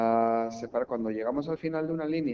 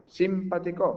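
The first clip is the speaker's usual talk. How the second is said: SIM-patico with a high, leaning SIM; Simpático said as SÍMpatico; wrong stress on first syllable; 6 semitones higher, 3 dB louder than usual. Shown as a demonstration. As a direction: misplace the beat onto SIM in Simpático. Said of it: "Pues no".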